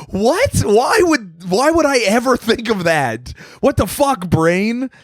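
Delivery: high-pitched, incredulous mock outrage; appalled disbelief. Waking up crying from a dream, appalled at own brain. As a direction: scold your own brain in a high, aghast voice.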